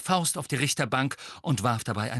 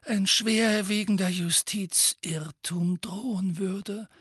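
measured, calm "schwerwiegender Justizirrtum"; 10 semitones higher, slower than usual. A narrator's reading. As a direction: state "schwerwiegender Justizirrtum" evenly and gravely. Dumbledore's calm take.

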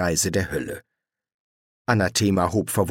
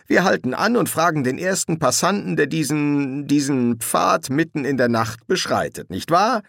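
raised pitch, mocking mock-proposal; sarcastic; 6 semitones higher than usual. A narrator's reading. Crowley ridicules asking a passerby at night, sarcastic. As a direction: pour sarcasm on the plan, ending on a high question.

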